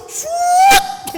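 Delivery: high, loud whooshing noise; imitated whoosh. Preacher showing angels racing off, imitated whoosh. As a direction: whoosh high like something darting off.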